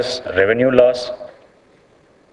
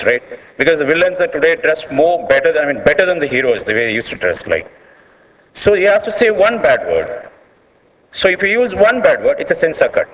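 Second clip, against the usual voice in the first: usual speaking voice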